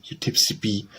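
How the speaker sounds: ordinary delivery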